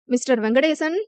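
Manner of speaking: usual speaking voice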